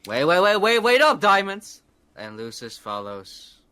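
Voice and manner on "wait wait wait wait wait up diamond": high, loud, urgent call; calling after someone. Acted. Adventurer to companion dashing into the cave, urgent high call. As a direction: shout for a runaway companion to wait.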